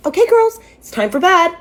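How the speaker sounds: high, loud singsong announcement; mock a parent sending children to bed, pitched high; imitated bedtime parent. Dad's girlfriend ordering grown sisters to bed, mock-parental singsong. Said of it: "she waltzes into the living room and says".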